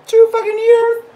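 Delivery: loud, very high-pitched disbelieving squawk; loud, high-flying incredulity; incredulous outburst. Acted. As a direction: blurt it out way up high in mock outrage. Mock outrage at long timelines.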